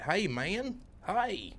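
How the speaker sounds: high-pitched country holler, imitated; deep country accent. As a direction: call after someone in a mock country twang. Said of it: "I'm talking deep country".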